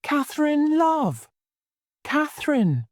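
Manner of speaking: high, pleading shocked call; dismayed lover crying out her name. A narrator's reading. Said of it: "cried Edgar, shocked".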